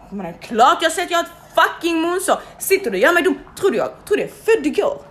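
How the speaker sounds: angry rant. Retold or imitated. Patient ranting at the dismissive nurse, angry and loud. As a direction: tear into her, insulted.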